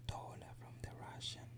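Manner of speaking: hushed whisper about politics. Real, not acted. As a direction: whisper it so nobody hears. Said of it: "Why are you whispering?".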